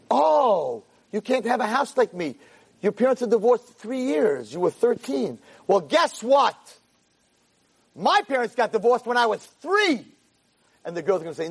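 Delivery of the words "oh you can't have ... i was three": high, emphatic comeback; one-upping retort. Acted. Mother tops the girl's divorce story, emphatic retort. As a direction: build to a triumphant punch at the end.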